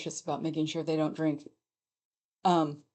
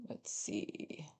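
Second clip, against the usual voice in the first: breathy whisper; whispering to self; 3 semitones lower, 16 dB quieter than usual. Real, not acted. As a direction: whisper it to yourself. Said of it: "whispers to self".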